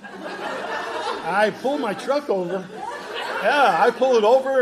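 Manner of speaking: laughing loudly through the words; high, loud laughing retelling. Real, not acted. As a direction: burst out laughing as you tell it. Laughing while retelling shock.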